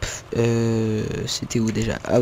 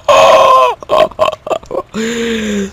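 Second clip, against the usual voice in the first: laugh; 7 semitones higher, 5 dB louder than usual. Real, not acted. Laughing at in-game driver.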